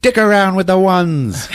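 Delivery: high, loud hammed-up robber voice; mock robber. Jane robbing the gas station, mock tough voice. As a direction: demand the cash in a silly squeak. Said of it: "She's like".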